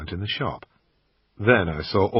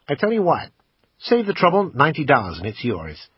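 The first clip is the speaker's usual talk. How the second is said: a higher, louder American salesman pitching the camera; pawn shop salesman; 6 semitones higher, 4 dB louder than usual. Acted. Assistant pitching the camera to the customer, salesman voice. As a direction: sell it like a pushy shop assistant.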